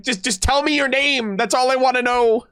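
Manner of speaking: high, pleading mock outburst; mock exasperation. Streamer begs the character for her name, mock exasperated.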